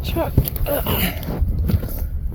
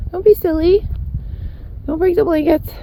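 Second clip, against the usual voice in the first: usual speaking voice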